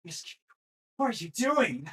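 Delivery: high, loud mock whine of a confused kid; whiny kid voice. Imitating a kid asking the coach what he's doing. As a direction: squeak it out like a lost child. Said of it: "And I'm like".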